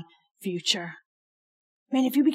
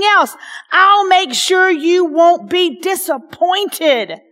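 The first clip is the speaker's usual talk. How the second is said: rising high and loud, building with passion; climbing preacher's fire; fervent preaching; 6 semitones higher, 5 dB louder than usual. Acted. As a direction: preach the promise with full force. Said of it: "I'm putting my, my attitude in with it".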